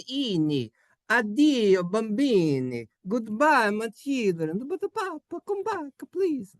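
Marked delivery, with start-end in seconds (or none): 1.1-4.7 high-starting Italian papa's farewell; Italian accent. Acted. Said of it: "don't say it with that accent". 4.7-6.6 put-on very high, softer Italian kid voice; pleading Italian child. Italian child begs papa to return.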